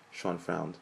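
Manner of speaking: ordinary delivery, nothing marked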